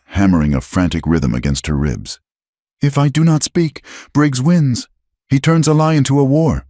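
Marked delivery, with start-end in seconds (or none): 2.8-6.7 put-on high, firm young woman's voice; determined young woman. A narrator's reading. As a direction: voice her resolute and raised.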